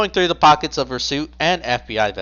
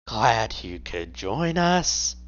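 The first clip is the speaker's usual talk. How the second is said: slow quiet menace; 5 dB quieter, much slower than usual. Acted. Michael greeting the player.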